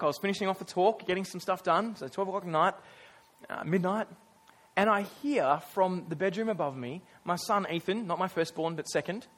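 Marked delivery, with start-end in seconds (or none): none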